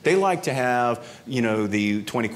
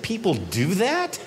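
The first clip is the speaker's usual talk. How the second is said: higher, slow wide-eyed question; mock innocent surprise; 8 semitones higher, slower than usual. Traveller plays innocent to the agent, slow surprised. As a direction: play dumb, astonished. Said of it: "And I said".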